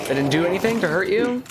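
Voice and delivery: fast high put-on voice; squeaky mocking taunt. Taunting enemy, mock voice.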